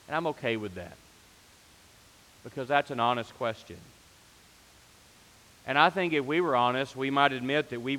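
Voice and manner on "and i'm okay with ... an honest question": ordinary delivery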